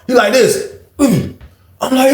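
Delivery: normal talking voice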